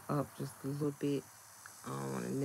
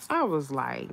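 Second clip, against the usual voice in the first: ordinary delivery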